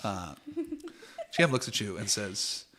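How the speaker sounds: ordinary talk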